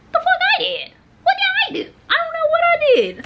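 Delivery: very high, squealed protest; shocked disbelief. Retold or imitated. Student hearing herself paged to office, high-pitched shock. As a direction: protest your innocence way up high. Retold shock at being called in.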